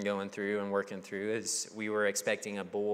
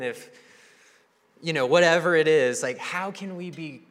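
whiny protest; 4 semitones higher, 5 dB louder than usual. Retold or imitated. Congregation complaining to Paul.

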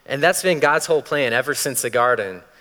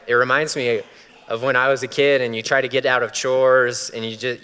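ordinary talk, nothing marked

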